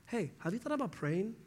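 a light imitation of a churchy friend; mock pious suggestion. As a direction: offer it like an easy fix.

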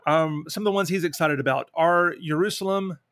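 normal talking voice

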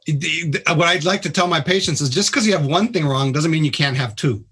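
ordinary talk

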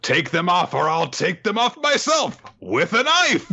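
high-pitched, hammed-up threat; threatening kidnapper. Kidnapper ordering girl to change, mock furious. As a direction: rant it like a flustered villain. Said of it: "And he's like".

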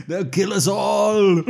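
high, very loud, drawn-out cry; hammed-up yell. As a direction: wail a mock doom warning at full volume. Acted cry in mocked film scene.

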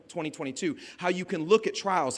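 normal talking voice

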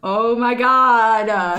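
very slow, loud, awed oh my god; drawn-out gushing amazement. Real, not acted. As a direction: stretch it out in loud disbelief.

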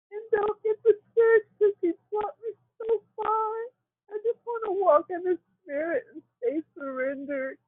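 tearful, high pitched and breaking; pushing the words out through tears; crying. Real, not acted. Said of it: "I want you to get it out".

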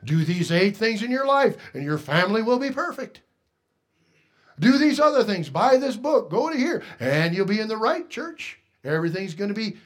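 a hint of a glib preacher; mock prosperity preacher. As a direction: sell it like a cheap pitch. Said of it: "In our churches today, we have".

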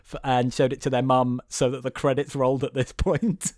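ordinary talk, nothing marked

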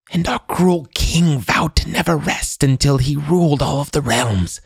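put-on high old woman's voice, words spilling out; eager old crone. A narrator's reading. Old woman telling the shield's secret history, eager high crone. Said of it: "She spoke quickly now, eagerly".

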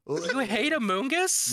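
high, drawn-out disbelief; incredulous. Real, not acted. Defending a favorite Pokemon from friends, incredulous.

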